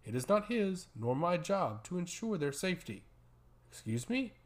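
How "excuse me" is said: a higher, rising excuse me in mild disbelief; taken aback. A narrator's reading. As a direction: let the question lift up, offended and surprised. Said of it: "inquired Zukrek, taken aback".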